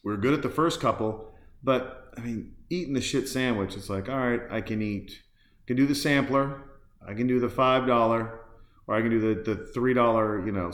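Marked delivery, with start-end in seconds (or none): none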